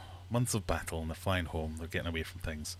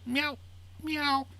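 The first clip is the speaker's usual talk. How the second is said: very high kitten mews; imitation cat meow. Scotsman in a sack posing as kittens, high meowing. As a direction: mew like a little cat. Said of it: "and the Scotsman goes".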